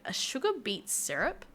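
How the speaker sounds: puzzled repeat with the pitch lifting at the end; incredulous question. Real, not acted. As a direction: repeat "sugar beet syrup" as if you can't believe it.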